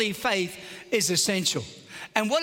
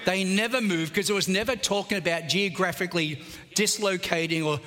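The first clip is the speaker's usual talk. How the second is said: ordinary talk, nothing marked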